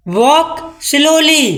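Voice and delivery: drawn-out slowly. Shown as a demonstration. Teacher drawing out the words for her students, very slow. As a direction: stretch every sound of slowly way out. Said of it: "slowly मतलब आराम से".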